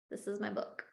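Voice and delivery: barely above a breath, fighting tears; choked up, near tears. Real, not acted. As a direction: say it as the emotion catches your throat. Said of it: "You're gonna make me cry".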